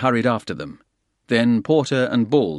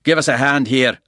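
louder call across the room; 4 semitones higher, 6 dB louder than usual. A narrator's reading. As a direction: call for help. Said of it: "Bald called out".

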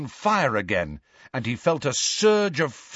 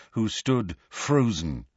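plain reading voice

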